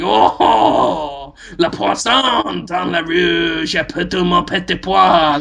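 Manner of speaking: slow hammed-up fake French; mock French. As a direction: drag out nonsense French like a lover.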